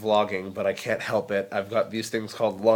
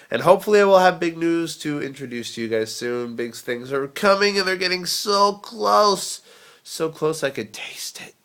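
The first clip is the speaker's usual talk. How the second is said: building excitement; 7 semitones higher, 5 dB louder than usual. Real, not acted. Teasing big news to viewers, mounting excitement. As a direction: build to a high, stretched peak, then ease off.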